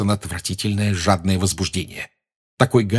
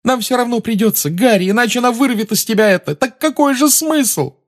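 put-on pleading girl; 4 dB louder than usual. A narrator's reading. Hermione pleading.

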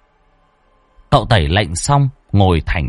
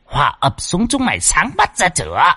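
shrill possessed scolding; 7 semitones higher than usual. A narrator's reading. Ancestral spirit berating the henpecked man, shrill nagging. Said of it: "lại cất tiếng lanh lảnh".

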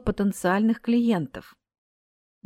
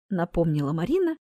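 ordinary delivery

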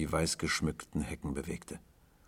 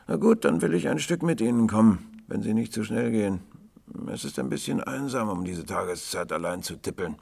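put-on higher, eager boy's voice; frail young boy; 6 semitones higher, 5 dB louder than usual. A narrator's reading. Lonely boy asking to walk along with tramp, high youthful voice. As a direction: pitch it up like a lad tagging along.

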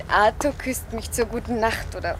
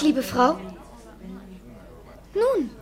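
ordinary delivery

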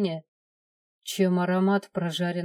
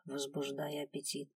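usual speaking voice

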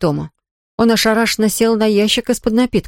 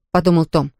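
ordinary delivery

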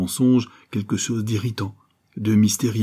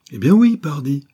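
loud retort; 7 semitones higher, 6 dB louder than usual. A narrator's reading. Accused peasant snaps back at Abel, loud and scornful.

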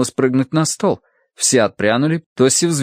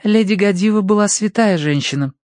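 higher-pitched defending voice; standing up for someone; 9 semitones higher than usual. A narrator's reading. Verity stands up for Lady Godiva, higher defending voice. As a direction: speak up in her defence. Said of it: "вступилась Верити".

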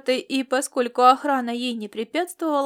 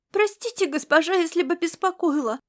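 put-on high, childlike apologetic call; little girl's raised voice; girl crying out; 6 semitones higher than usual. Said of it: "выкрикнула растрёпанная светловолосая девчонка".

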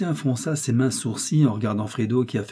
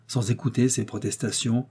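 ordinary delivery

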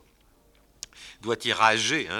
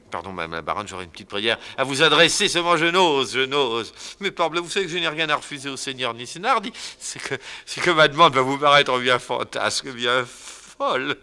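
higher, louder mock-shy pleading; hammed-up timid request; 4 semitones higher, 6 dB louder than usual. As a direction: beg as if you dare not ask. Fadinard timidly pleading with baroness.

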